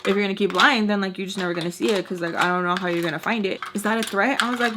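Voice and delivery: ordinary talk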